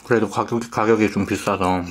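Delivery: chewing while talking; speaking between bites; mouth full. Real, not acted. Seen 2: Cheeks look full while he talks, holding the burger and feeding in more bites.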